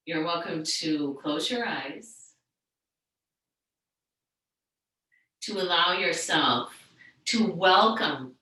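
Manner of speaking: soothing yoga teacher settling the room; guided meditation. Real, not acted. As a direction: gently lead a class into stillness, unhurried. Leads a seated meditation.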